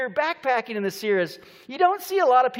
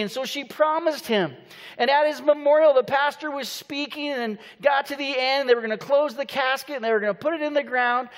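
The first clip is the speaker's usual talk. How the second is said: ordinary delivery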